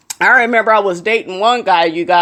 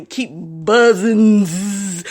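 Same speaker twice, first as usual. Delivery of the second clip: buzzing fly noise; much slower than usual. Retold or imitated.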